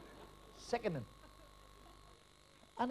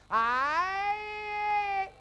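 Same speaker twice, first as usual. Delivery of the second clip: put-on drunk's shout; 13 semitones higher, 3 dB louder than usual. Drunk shouting back across seats.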